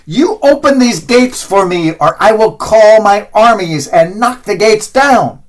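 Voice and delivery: loud, high threat from the dead pope; furious pope shouting at the gate; threatening. A narrator's reading. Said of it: "Julius threatens".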